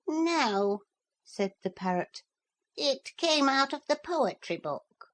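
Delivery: high, bird-like parrot voice; chirpy know-all parrot explaining. Acted. Parrot answers Philip, high and bird-like.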